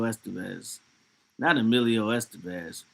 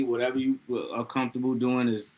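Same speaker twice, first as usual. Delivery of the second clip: normal talking voice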